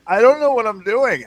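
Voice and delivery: mock panic, high and loud; hammed-up panic; panicked lost soldier. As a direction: whine it like a lost private. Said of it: "they're just like".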